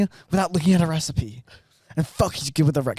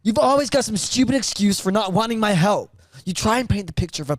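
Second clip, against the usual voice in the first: high, defensive son; defensive teen; 8 semitones higher than usual. Acted. Son defending himself to his father. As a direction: push back like a stung teenager. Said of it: "basic American accents".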